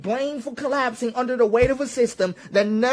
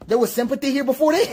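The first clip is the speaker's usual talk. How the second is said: fast, higher question breaking into real laughter; incredulous laughing; 5 semitones higher, faster than usual. Real, not acted. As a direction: ask in disbelief and crack up at the end.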